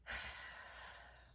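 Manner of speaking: long exasperated sigh; heavy sigh. Real, not acted. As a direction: let out a tired sigh. Exasperated after the angry outburst.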